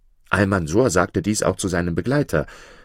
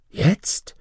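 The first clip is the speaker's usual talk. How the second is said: startled breathless whisper; frightened. A narrator's reading. Almansor startled by the offer, whispering in fright. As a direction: gasp the word out in alarm. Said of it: "rief der Jüngling mit Schrecken".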